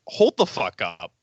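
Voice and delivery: an indignant Luke halting Yoda; mock Luke. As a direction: drop the voice and stop him cold. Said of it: "Luke's like".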